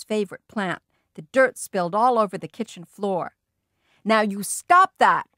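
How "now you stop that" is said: yelling. A narrator's reading. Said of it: "my father yelled at Fudge".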